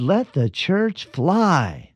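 high, very slow, drawn-out pulpit cry; imitating a fired-up country preacher; mock preacher shout. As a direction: ham up a revival preacher hitting his peak. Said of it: "shouted the preacher".

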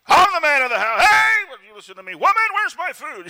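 hammed-up bossy husband, shouted high and loud; mock tyrant husband. A preacher mocking a tyrant husband shouting at his wife, hammed-up shout. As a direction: bark it out like a tyrant at home. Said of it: "rule with an iron fist as tyrants".

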